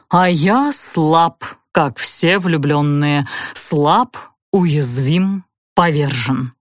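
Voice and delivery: very slow, low languishing confession; drawn-out theatrical self-pity; defeated lover. A narrator's reading. Prince plays the defeated lover.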